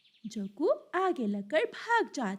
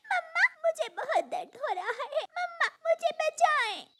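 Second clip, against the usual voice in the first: very high, tearful plea for help; crying. Acted. Fallen little bird begging mother's help, crying. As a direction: wail like a hurt little child. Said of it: "रोने लगती है".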